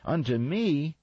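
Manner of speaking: sharp high yelled question; yelled back. A narrator's reading. Imogene yelling back at Gladys during rehearsal. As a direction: fire it back indignantly, pitched high. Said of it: "Imogene yelled back at her".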